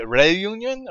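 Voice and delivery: Reunion with the first syllable as "ray"; accented E pronunciation. Shown as a demonstration. Gamer guessing at a country's name, accented E pronunciation. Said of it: "accent over the E, so I'm thinking it's like".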